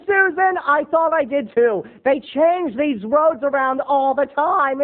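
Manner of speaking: high, slow defensive dad. Retold or imitated. Husband defending himself to wife, high slow. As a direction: excuse himself slowly. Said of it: "He'd say".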